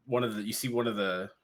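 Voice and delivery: ordinary talk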